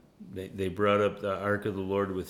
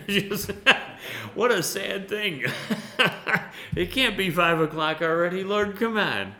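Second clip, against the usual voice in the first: high, loud laughing complaint; laughing grumble; 11 semitones higher, 7 dB louder than usual. Real, not acted. Preacher laughing loudly at his grumbling at five. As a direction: laugh at your own grumbling.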